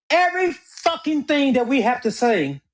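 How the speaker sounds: "fucking" hit hard and high; heavy emphasis landing on "fucking"; stress on fucking. Real, not acted. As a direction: lean into "fucking" with force. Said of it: "let me put emphasis on the word fucking".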